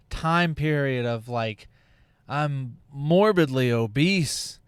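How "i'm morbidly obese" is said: usual speaking voice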